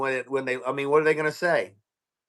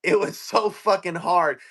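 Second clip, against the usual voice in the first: ordinary talk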